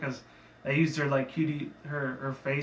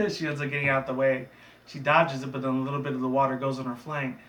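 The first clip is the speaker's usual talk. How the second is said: ordinary delivery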